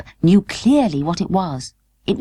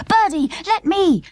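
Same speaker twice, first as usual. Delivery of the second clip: mild mock scream; 12 semitones higher than usual. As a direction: plead to take her place. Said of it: "screamed Totty".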